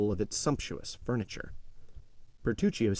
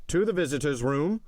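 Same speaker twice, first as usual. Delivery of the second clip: higher-pitched official summons; inspector's call; 5 semitones higher, 4 dB louder than usual. A narrator's reading. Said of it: "said the same voice".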